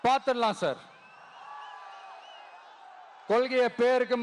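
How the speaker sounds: ringing political speech with long-held line endings; rally oratory. Real, not acted. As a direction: rouse a huge crowd, pausing for cheers. (மேடைப் பேச்சு, முழக்கமாக)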